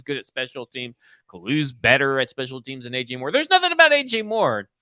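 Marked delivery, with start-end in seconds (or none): 3.3-4.7 high, loud exclamation; emphatic outburst. Real, not acted. Co-host dismissing a new signing to his partner, emphatic and high-pitched. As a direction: burst out with it, pitched way up. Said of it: "I'll say it like I said it again".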